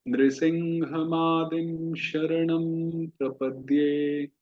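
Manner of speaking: very slow chant-like prayer recitation; slow Sanskrit recitation. Shown as a demonstration. Teacher leading students in Narasimha prayer: slow recitation. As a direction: recite each line slowly for the class to repeat. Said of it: "मेरे पीछे उच्चारण कीजिए".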